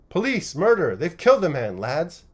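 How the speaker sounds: high, loud panicked yelling; fearful shout. A narrator's reading. Bloodied smith shouting for help to the crowd, fearful. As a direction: scream for help as if in danger. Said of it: "shouted in a fearful voice".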